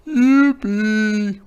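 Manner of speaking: loud, high bleating yippee; excited goat whoop; braying goat. Acted. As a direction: bray it with joy. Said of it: "brayed Goat".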